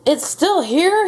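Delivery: slow, high, loud disbelief; furious cry. A narrator's reading. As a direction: cry out in storybook fury. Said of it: "filled with fury".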